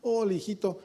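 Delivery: a mother welcoming her pastor son home; sweet old mother. Retold or imitated. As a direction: greet the son tenderly. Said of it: "mi mamá decía".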